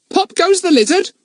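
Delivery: very high, loud squawky parrot; the parrot mocking the lizard in a high, loud parrot voice. A narrator's reading. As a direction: chirp it out like a cheeky parrot. Kiki the parrot speaks.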